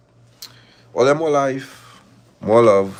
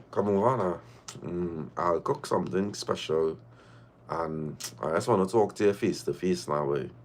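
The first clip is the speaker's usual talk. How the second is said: soft invitation; 6 semitones lower, 9 dB quieter than usual. Retold or imitated. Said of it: "One night he say".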